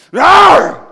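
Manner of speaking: sudden huge roaring growl, very loud and high; lion roar. Retold or imitated. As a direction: let out a startling lion roar. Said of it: "all of a sudden he goes".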